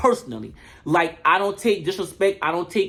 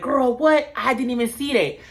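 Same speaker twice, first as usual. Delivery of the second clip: mock shock; 8 semitones higher than usual. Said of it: "Tinker was like".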